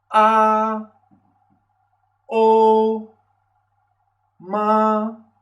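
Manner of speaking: "a", "o", "ma" each held high and level; long high flat Mandarin tone on "a", "o", "ma"; first tone. Shown as a demonstration. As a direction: sustain "a", "o", "ma" loudly on one even pitch. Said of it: "este tono mantenido".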